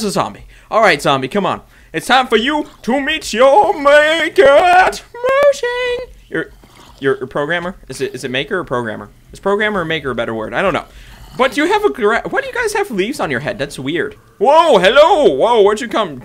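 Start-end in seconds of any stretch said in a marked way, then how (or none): 1.9-6.1 high, loud, slow movie threat; dramatic hero taunt. Acted. Taunting zombie, dramatic line. 14.4-16.2 high, loud surprised whoa; startled greeting. Real, not acted. Zombie suddenly appears.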